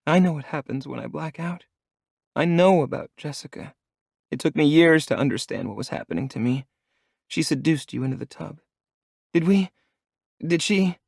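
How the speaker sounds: put-on frightened young woman, ashamed; timid young woman. A narrator's reading.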